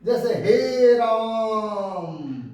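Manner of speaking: राम held very slow with the आ stretched; prolonged vowel. Shown as a demonstration. Teacher showing long vowel to students, drawn-out राम. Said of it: "इसको उच्चारण करने में दुगुना समय लगता है".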